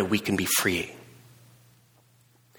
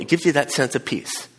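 ordinary talk

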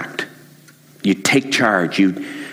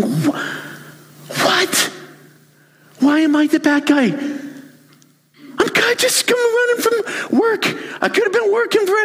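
much higher whiny protest; wounded exasperation. Acted. Husband protests to his disgusted wife, whining. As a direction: squeal it out like the wronged party. Hurt husband defends himself.

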